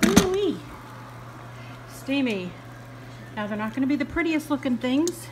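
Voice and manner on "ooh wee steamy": normal talking voice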